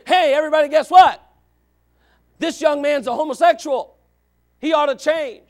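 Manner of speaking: loud, high call to the whole room; mock town-crier broadcast; public announcement. Tactless churchgoer outing a young man to everyone, loudly announcing.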